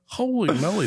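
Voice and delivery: high-pitched retold awe; wowed quoted exclamation; acted amazement. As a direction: replay being amazed by a huge catch. Acts out seeing the huge fish.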